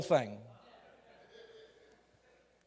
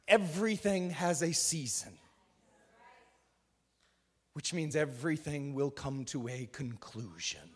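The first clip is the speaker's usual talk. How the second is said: intimate prayer whisper-close; hushed prayer; 5 semitones lower than usual. Acted. Soft reflective prayer.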